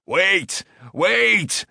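urgent high-pitched calls; crying out. A narrator's reading. Smith trying to stop the hammering boy, crying out. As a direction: call out to halt him, pitch jumping up. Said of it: "cried Karl".

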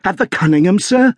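very high, urgent butler's question; gasped alarm. A narrator's reading. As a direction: blurt it out in high-pitched agitation. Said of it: "he gasped".

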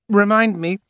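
high, loud, steady demand. A narrator's reading. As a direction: call it out firmly. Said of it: "in a loud, steady voice".

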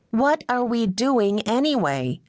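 high, slow, insistent what; probing. A narrator's reading. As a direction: press the question slowly as Meg. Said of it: "she probed once more".

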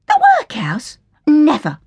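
high, shocked outburst at the idea; appalled recoil. Acted. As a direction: recoil from the word in horror, up high. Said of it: "Hilda recoiled".

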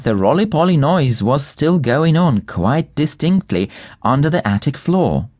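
usual speaking voice

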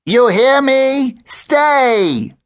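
high, loud, slow squawked command; bird echoing. A narrator's reading. Gabby echoes Grandpa from her branch, high bird voice. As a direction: parrot Grandpa's order. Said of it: "Gabby echoed".

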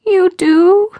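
put-on high, loud little-girl question; high childish voice, overcome; emotional child. A narrator's reading. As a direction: ask as a tearful child, full of emotion. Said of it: "Caroline's voice shook with emotion".

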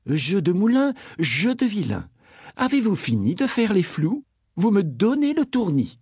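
a touch of a cross mother; mild scolding. Acted. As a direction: scold the children, understated. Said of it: "La princesse dut se fâcher".

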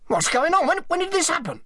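very high, agitated questioning; startled. A narrator's reading. Selby discovers the body behind the desk, startled. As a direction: blurt it out in shock.

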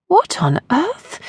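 high, slow puzzled question; baffled, to herself, drawn out; muttered surprise. Acted. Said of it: "Percy muttered".

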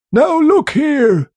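high, slow, tense sea captain; a cornered old seaman forcing it out; strained old captain. Acted.

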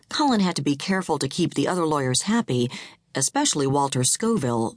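ordinary delivery, nothing marked

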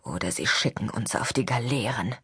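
low and soft, muttered bitterly. Acted. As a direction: drop it low under the breath. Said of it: "murmelte Philippas Vater".

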